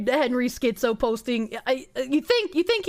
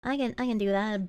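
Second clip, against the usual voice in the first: normal talking voice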